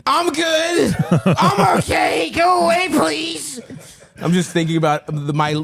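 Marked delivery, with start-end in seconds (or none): 0.1-3.6 high, strained, half-laughing shout; strained high cover-up. Retold or imitated. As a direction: squeak out that you're fine while hurting. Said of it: "I was like".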